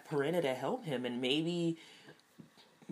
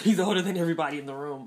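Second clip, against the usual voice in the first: quick laughing remark, louder; laughing aside; 2 semitones higher, 8 dB louder, much faster than usual. Real, not acted. Joking to viewers about the actor's age, laughing. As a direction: laugh through it fast.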